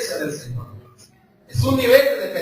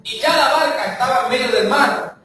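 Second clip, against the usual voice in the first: raised, declamatory Bible reading; scripture reading; 4 semitones higher than usual. Acted. Preacher reading Matthew aloud to the church, declaiming. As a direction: proclaim the passage to the congregation.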